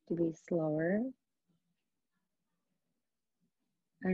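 unhurried yoga nidra guidance with long pauses; guided meditation. Acted. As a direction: guide them into relaxation, leaving space between phrases. Leads a yoga nidra practice.

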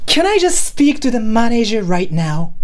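high, slow, insistent Karen complaint; demanding voice. Shown as a demonstration. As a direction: whine and demand, pitched high. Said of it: "in a demanding voice?".

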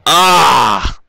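loud, drawn-out "ah"; frustrated cry. Real, not acted. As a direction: let out a loud cry at the game. Loses in the game.

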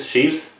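"Chvese" as SHEEVS, long ee; unsure pronunciation. Real, not acted. Reactor trying another reading of the name, unsure pronunciation. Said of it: "how to say this guy's name".

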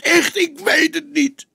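broken journalist begging, crying; crying like a child. A narrator's reading. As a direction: sob it out desperately. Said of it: "Hij huilde als een kind".

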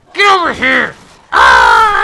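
loud call then roar; angry shout. Real, not acted. Gamer chasing a dog, angry shout. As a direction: bark it at the enemy.

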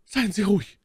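very high, ominous lady's voice; unreassuring reassurance. A narrator's reading. Milady calming her wounded count, in a far from soothing tone. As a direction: reassure with an undertone of menace. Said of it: "wenig beruhigend war".

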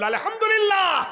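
high, loud shout of praise; soaring acted exclamation; exultant cry. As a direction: cry it out as seventy believers at once. Seventy cry out praise together.

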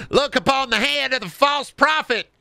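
high-pitched zealot denouncing a false prophet; mock prophecy. Zealot denouncing Larry the Cable Guy, mock prophecy. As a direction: proclaim it high like a hammed-up doomsayer.

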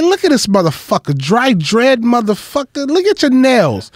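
comic imitates the loud guest roasting him; loud Rappaport. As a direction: yell the insults like a loud New York heckler. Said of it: "he's loud".

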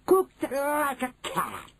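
high gleeful witch voice. Acted. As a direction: relish it as the witch.

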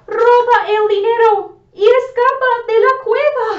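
excited shout. Acted. Grabbing the money and escaping.

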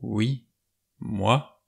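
halting, unsure admission; unsteady stammer. A narrator's reading. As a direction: admit it hesitantly, pausing between words. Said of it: "d'une voix mal assurée balbutia".

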